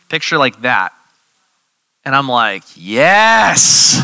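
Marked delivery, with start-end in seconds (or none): 2.8-4.0 sudden high, loud cry of joy; a joyful yelp of victory; excited yes. Retold or imitated. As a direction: burst out with delight. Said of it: "You never get so excited".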